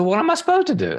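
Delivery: high-pitched mock-helpless protest; acted-out exasperated mother; exasperated question. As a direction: voice jumps up in bafflement. Mother exasperated in retold exchange.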